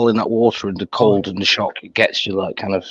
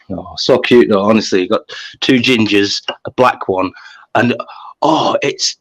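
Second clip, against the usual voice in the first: normal talking voice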